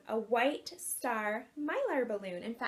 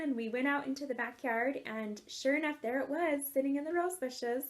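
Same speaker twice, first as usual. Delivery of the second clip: ordinary delivery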